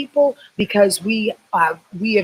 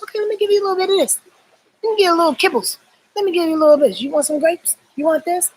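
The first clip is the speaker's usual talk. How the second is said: much higher, fast coaxing voice offering treats; teasing universe voice; 9 semitones higher, much faster than usual. Acted. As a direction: dangle little rewards, sing-song and quick. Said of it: "the universe goes".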